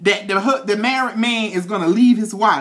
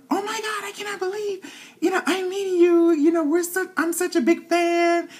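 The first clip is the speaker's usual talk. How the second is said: very high, light imitation of a thrilled fan; happy starstruck fan; 10 semitones higher than usual. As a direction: gush in a high-pitched fangirl voice. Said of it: "she was so happy".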